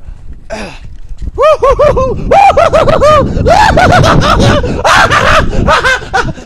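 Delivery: long, high, loud laughing shout into the storm; a drawn-out whooping laugh against the wind; laughing yell. Real, not acted. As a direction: let out a wild laughing holler. Overwhelmed by wind and snow.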